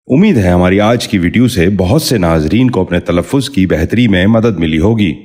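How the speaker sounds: ordinary talk, nothing marked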